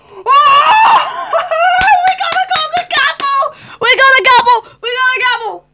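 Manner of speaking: very high, loud laughing scream of joy; ecstatic shriek. Real, not acted. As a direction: shriek with disbelieving delight. Finding golden gavel, shrieking laugh.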